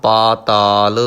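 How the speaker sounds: ਪਾਤਾਲ with a long ਪਾ and a long ਤਾ; correct pronunciation. Shown as a demonstration. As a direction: say ਪਾਤਾਲ slowly, both vowels long. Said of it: "ਪਤਾਲ ਨਹੀਂ ਪੜ੍ਹਨਾ". (ਪਾਤਾਲ ਦਾ ਸਹੀ ਉਚਾਰਨ)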